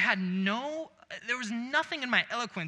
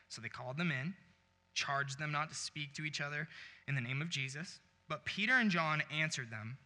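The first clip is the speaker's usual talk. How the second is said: measured, softer Bible reading voice; scripture reading; 4 semitones lower, 5 dB quieter than usual. Acted. Pastor reading from Acts to his congregation, measured. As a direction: read the verses steadily to the church. Reads scripture passage aloud.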